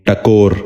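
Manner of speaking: ٹکور with short a, "takor"; first vowel of ٹکور as short a; correct pronunciation. Shown as a demonstration. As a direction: say ٹکور as "ta-KOR". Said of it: "آپ بھی غور سے سنیے".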